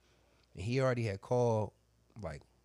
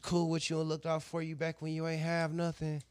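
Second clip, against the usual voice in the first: mock woman voice; 7 semitones higher, 11 dB louder than usual. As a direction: drag out her bitter goodbye in a put-on voice. Woman's parting jab, retold.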